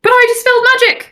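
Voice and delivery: high-pitched outraged protest; indignant disbelief. Real, not acted. Player protests magic failing, indignant high cry. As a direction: cry out at the unfair wall.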